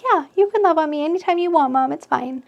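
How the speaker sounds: dog's voice. Retold or imitated. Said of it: "He's like".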